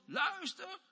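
high, insistent demand to be heard; fiery plea. Acted. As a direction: pray with fire, pitch leaping up. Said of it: "daar zit vuur in". (vurig, dringend aanroepen)

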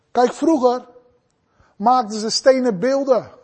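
normal talking voice

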